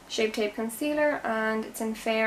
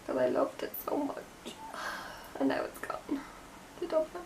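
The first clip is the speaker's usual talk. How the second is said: whisper; 5 semitones higher, 8 dB quieter than usual. Real, not acted. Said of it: "I was so upset".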